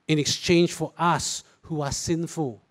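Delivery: ordinary delivery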